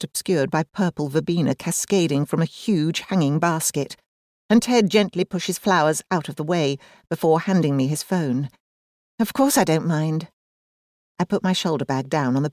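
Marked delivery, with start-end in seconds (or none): none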